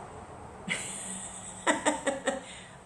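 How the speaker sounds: long, high, airy laugh; amused laugh. Real, not acted. Teacher tickled by his play on words, long laugh. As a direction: laugh at your own pun.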